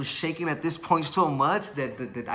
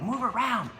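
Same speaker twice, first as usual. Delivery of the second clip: much higher-pitched coaching call; urging trainer; 8 semitones higher than usual. Retold or imitated. Trainer to tired boxer in the corner, urging. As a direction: egg the fighter on in a raised pitch.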